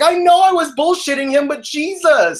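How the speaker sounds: high-pitched exclaimed protest; exasperated outburst. Retold or imitated. Worker venting to his manager about the customer, exasperated outburst.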